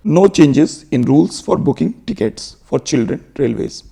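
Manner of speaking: slow, deliberate English read-aloud; slow headline reading. Shown as a demonstration. Teacher reading the headline aloud to students, slow and careful. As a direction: read each word out slowly for learners.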